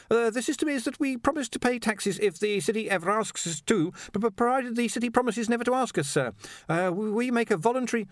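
high, stammering nervous assistant; flustered underling. A narrator's reading. Ponder explains the police to his master, flustered. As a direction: stumble through the report, deferentially.

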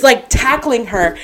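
normal talking voice

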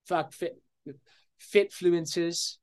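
fitfluences with a slipped-in British accent. Real, not acted. Naming the topic of today's episode in a British accent. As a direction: say fitfluences the British way, half-unaware. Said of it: "I just veer towards speaking a British accent".

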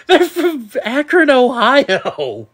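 high-pitched, laughing exclamation; laughing protest. Real, not acted. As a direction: squeal it out while cracking up. Laughing at the accent bit.

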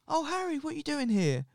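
high, surprised woman's voice; surprised mum. Retold or imitated. Ex's mum at her door, a high surprised voice. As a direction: lift high, caught off guard at the door.

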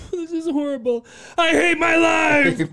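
high, loud wailing complaint; crying girl on phone. Retold or imitated. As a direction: wail like a heartbroken caller. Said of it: "girl on the phone".

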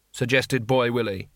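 plain reading voice

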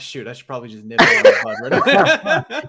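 laughing through the words at his own slip. Real, not acted. Catches his own joke.

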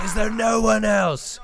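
mock-shout the taunt; taunting challenge. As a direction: Achilles daring anyone else to fight. Achilles taunts the army.

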